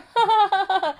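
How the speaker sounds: high, put-on gloating cackle; mock gloating laugh. Imitating a sociopath enjoying your anger, gloating laugh. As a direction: laugh mockingly at someone's anger.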